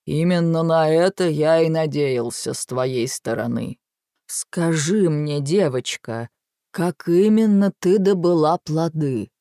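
put-on a patient old giant questioning; slow giant voice. A narrator's reading.

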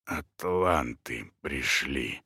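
low, slow, hoarse and failing. A narrator's reading. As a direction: rasp it out, barely standing. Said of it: "хриплым голосом проговорил он".

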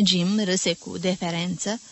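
plain reading voice

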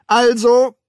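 loud, high call to attention. A narrator's reading. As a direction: call out to restart proceedings. Official calls crowd to continue. (laut aufrufend)

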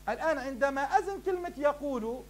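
normal talking voice

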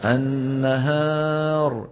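النهار stretched very slowly with a clear, light ه. Shown as a demonstration. Teacher modelling النهار for students. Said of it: "شو لازم يقول؟".

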